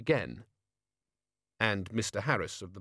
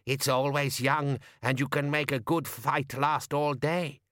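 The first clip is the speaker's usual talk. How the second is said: lightly voiced old crone; a hint of a cackling old woman; 3 semitones higher than usual. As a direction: speak as a knowing old woman. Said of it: "cackle from a very old woman".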